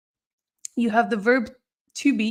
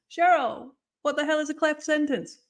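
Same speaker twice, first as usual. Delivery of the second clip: higher-pitched student demanding an answer; mock-baffled, pitched up; baffled student question; 6 semitones higher than usual. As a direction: play a bewildered student calling on the teacher.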